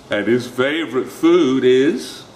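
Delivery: slow, wary question; wary fox. Acted. The fox asks the mouse, slow and wary. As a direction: ask it half dreading the answer. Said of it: "fox asks warily".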